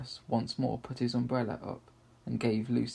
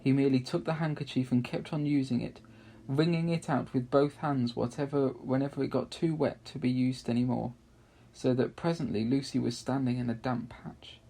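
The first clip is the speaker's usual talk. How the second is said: ordinary delivery, nothing marked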